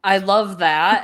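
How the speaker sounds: ordinary delivery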